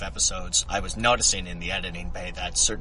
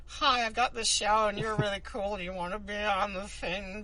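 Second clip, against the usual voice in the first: much higher, eager and timid; nervous fan voice; 11 semitones higher than usual. Retold or imitated. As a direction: shyly ask with a nervous laugh. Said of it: "to be all like".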